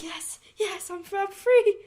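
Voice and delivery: high, mock-triumphant cry; mock elated villain. Mimicking the villain who thought he was safe, high and gleeful. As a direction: squeal it out as if you've escaped.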